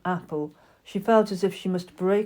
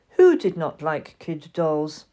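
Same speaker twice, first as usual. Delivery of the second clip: ordinary delivery, nothing marked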